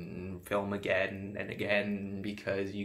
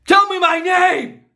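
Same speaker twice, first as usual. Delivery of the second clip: screamed demand, much higher and louder; furious shout; 15 dB louder than usual. Acted. Obsessed extra screams at his captive star. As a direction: scream it in her face.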